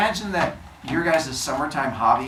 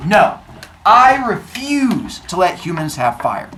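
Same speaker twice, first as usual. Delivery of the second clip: loud, firm and slow no; Zeus shutting the idea down hard; booming slow refusal from the king of gods; stern refusal; 4 semitones higher, 8 dB louder, slower than usual. Acted.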